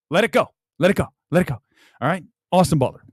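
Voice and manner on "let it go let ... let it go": "let it go" short and offhand; thrown away. Shown as a demonstration. Acting teacher showing the right way, "let it go" thrown away. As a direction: toss off "let it go" without weight. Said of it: "Throw it away. When you say it".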